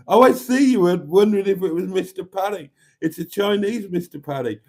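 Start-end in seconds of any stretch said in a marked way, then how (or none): 0.1-2.7 high, loud "oh, I see"; the penny drops, squeal it; host suddenly gets the joke, surprised; sudden realisation. Real, not acted. Sudden realisation reading chat.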